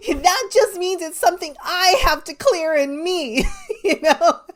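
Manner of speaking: giggling through the punchline; laughing. Real, not acted. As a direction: laugh as you explain it. Laughing while telling story.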